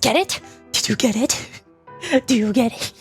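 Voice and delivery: usual speaking voice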